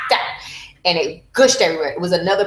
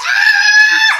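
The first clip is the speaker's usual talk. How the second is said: very high loud scream of pain; retold scream; 8 dB louder than usual. Re-enacting the moment the head came, a high scream. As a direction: cry out as the head comes. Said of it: "So I was like".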